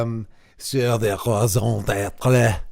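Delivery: slow, hammed-up French accent; mock French accent. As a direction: put on a jokey French accent, slowly. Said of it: "that's my French accent".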